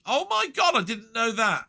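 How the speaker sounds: high, loud burst of real surprise; shocked exclamation. Real, not acted. Browsing film lists and shocked by a new title, surprised outburst. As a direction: caught off guard by the news, gasping it out. Spots an unexpected film listing.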